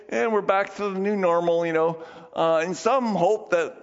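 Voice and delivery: ordinary talk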